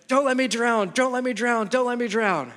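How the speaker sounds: imagined frightened daughter voice; panicked child. Retold or imitated. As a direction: imitate a scared little girl begging.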